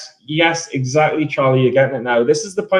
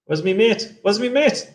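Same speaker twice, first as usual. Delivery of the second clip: pitched up higher each time, rising; 7 semitones higher than usual. Shown as a demonstration. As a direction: climb higher with each take.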